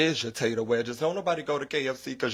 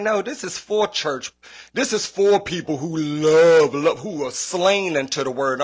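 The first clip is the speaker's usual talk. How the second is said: slow, louder, preachy put-on; mock churchy voice; 3 semitones higher, 5 dB louder than usual. Kid mocking the church crowd's KFC, slow preachy sendup. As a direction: ham it up like a deacon at a potluck.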